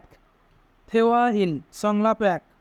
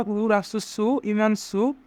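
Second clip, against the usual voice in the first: normal talking voice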